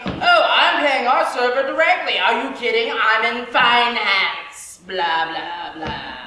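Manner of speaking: loud pompous bragging; loud mock finance guy, trailing into blah blah; mock bark. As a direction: bark it like a show-off. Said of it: "bark over at her".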